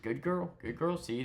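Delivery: high sing-song praise to a dog; soothing dog praise. Retold or imitated. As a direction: coax a nervous dog gently. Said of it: "just had to be like".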